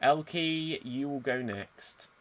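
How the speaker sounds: ordinary delivery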